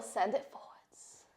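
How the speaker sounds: soft unvoiced whisper, slow. Real, not acted. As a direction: breathe the cue out as a whisper. Soft cue during slow stretch.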